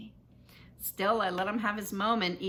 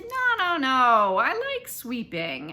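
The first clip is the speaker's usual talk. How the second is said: mock enthusiasm; 2 semitones higher, 7 dB louder, much slower than usual. A narrator's reading. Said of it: "as if I really loved it".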